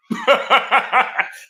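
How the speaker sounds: a burst of loud, high laughter; real hearty laugh. Real, not acted. Laughing about the police.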